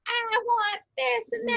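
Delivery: put-on very high-pitched, drawn-out kid whine; demanding child. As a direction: play the one needy child demanding things. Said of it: "that one is like".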